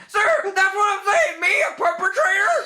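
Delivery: panicky shouting officer. Acted. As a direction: shout every word like a nervous rookie cop. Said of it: "I kinda like that voice for him".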